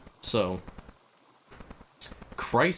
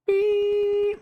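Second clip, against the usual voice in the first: a long, much higher, louder beep sound; imitated alarm beep; 5 dB louder than usual. Mimicking the power supply alarm, high held beep. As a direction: squeal out the machine's beep. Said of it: "but this is just".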